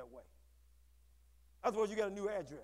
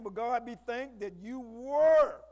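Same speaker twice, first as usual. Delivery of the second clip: slow, weighty reading; 8 dB louder, much slower than usual. Shown as a demonstration. Emphatic scripture reading.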